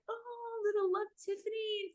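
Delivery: soft, high, sympathetic sigh; tender sympathy. Retold or imitated. Comforting a sobbing friend whose girlfriend died, soft tender voice. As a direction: coo it gently, full of sympathy.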